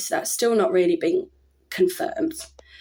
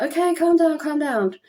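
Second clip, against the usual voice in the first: higher, mock soothing staff voice; lightly playing the calming nurse, pitched up; mimicked nurse; 8 semitones higher than usual. Staff soothing her during procedure.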